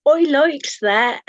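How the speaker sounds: slow, loud mock Cornish accent, pitched high; hammed-up Cornish accent; attempted Cornish accent. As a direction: have a playful go at Cornish, drawn out and loud. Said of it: "That was my attempt at a Cornish accent".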